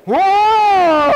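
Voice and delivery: high, loud startled whoa. Retold or imitated. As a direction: yelp like a cold blast hit you. Workers reacting to cold.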